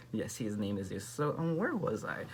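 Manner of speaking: ordinary delivery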